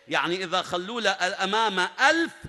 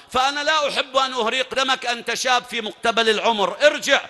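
higher and louder, building to a shouted order; arrogant warning; 6 semitones higher, 6 dB louder than usual. Acted. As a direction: swell into a contemptuous command to go back. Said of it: "عمرو هنا قال". (تحذير متعال يتصاعد إلى صراخ)

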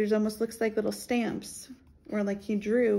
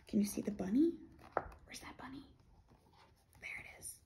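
whisper; 7 dB quieter than usual. Acted. Hushed bunny search game.

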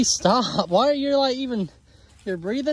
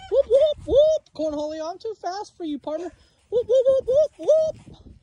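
squealing whoop whoop, much higher; high taunting whoops; 9 semitones higher than usual. Real, not acted. Juking the turkey Cornholio, high taunting whoops. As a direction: whoop high as you dodge.

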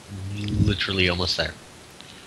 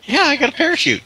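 gloating; 5 dB louder, faster than usual. Pretend. Gloating at friend.

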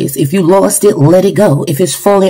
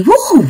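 joyful preacher's whoop; 6 semitones higher than usual. Real, not acted. Preacher's exclamation mid-sermon.

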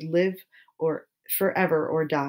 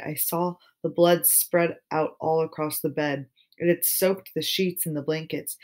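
ordinary delivery, nothing marked

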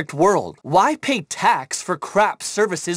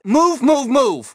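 loud, high urgent shout to move; shouting orders; 7 semitones higher, 5 dB louder, much slower than usual. Acted. Commander shouting at his crew to move, loud. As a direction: bark it at the crew to get going.

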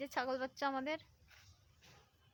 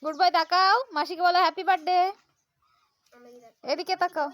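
squeaky praising a dog; 5 semitones higher, 12 dB louder than usual. Real, not acted. Coaxing a dog to look.

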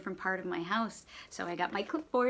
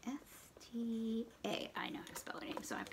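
whisper; 12 dB quieter than usual. Real, not acted. Teacher whispering aside while writing.

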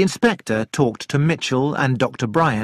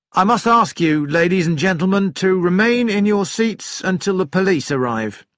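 raised, higher announcing voice; steward's announcement; 6 semitones higher, 4 dB louder than usual. A narrator's reading. Steward addresses passengers as the plane lands, announcing. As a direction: announce it formally to the whole cabin. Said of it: "he announced".

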